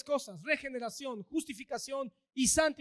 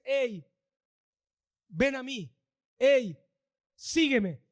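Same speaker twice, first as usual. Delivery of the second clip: louder calling out "hey, sígueme"; 6 dB louder, slower than usual. Acted. Jesus calling a sinner to follow him, loud call. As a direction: call them from across the road.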